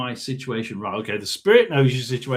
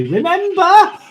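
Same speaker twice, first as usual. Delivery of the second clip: put-on high, light girlish voice with a rising question; young girl's voice; 14 semitones higher, 8 dB louder, slower than usual. Ghost hunter voicing a chirpy young girl spirit: high and rising. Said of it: "A young girl say".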